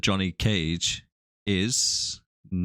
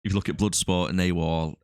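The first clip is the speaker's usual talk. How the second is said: ordinary delivery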